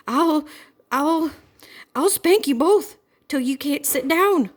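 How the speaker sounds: high, loud stammering threat; sputtering anger. A narrator's reading. Mother threatening the girls with a spanking, loud sputtering. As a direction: choke on the rage before the threat. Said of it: "She was so angry she could hardly speak".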